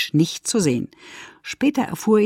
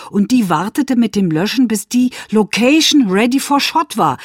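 normal talking voice

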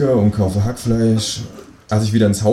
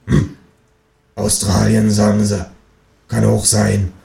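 neighbour voice; slower than usual. Acted. Nachbarin spricht.

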